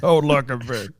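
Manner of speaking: a hint of a brooding monster; mock monster voice brushing off the butler. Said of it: "monster voice".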